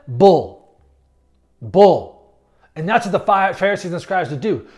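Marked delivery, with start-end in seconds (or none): 0.1-2.4 low, loud one-word verdict; emphatic, low rejection; blunt dismissal. Real, not acted. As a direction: drop the pitch and punch it out. Dismisses the gatekeeping idea.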